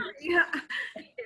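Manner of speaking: a laugh breaking into a sob; teary laugh. Real, not acted. Presenter choked up mid-speech, teary laugh. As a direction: laugh and cry at once. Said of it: "And I'm gonna cry again".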